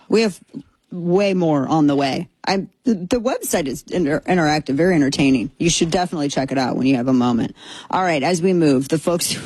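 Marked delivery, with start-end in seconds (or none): none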